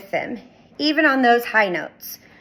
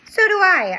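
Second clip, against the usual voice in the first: high squeaky hamster reply; squeaky agreement; 9 semitones higher, 4 dB louder than usual. Acted. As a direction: squeak it out eagerly. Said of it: "I squeaked".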